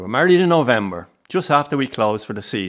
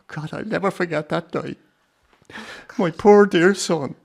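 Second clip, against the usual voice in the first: grief-stricken; 8 semitones higher, 4 dB louder than usual. Acted. Grandfather recalling son's fatal accident.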